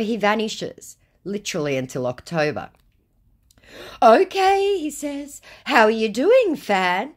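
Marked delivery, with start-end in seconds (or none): 4.0-7.1 high and loud, forgetful cheer; mock scammer voice. Scammer greeting her as a fan, mocked high. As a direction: chirp it brightly like a clueless fan page.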